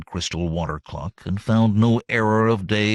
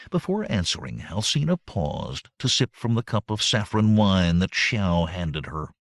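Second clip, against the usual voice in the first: usual speaking voice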